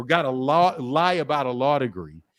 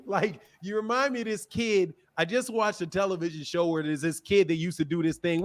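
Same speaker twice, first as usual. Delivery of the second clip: ordinary talk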